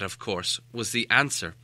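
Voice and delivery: ordinary delivery, nothing marked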